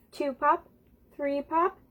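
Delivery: usual speaking voice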